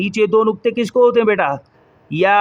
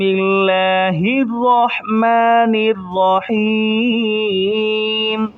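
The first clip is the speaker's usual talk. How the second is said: drawn-out, measured Quranic recitation; slow recitation; much slower than usual. Acted. Teacher opening the lesson for children, slow recitation. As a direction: recite it slowly and reverently.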